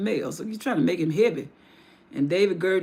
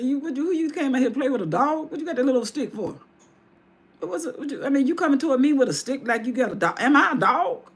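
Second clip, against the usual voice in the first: higher, fast taunting Goliath; mocking giant; 6 semitones higher, faster than usual. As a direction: sneer down at the little boy.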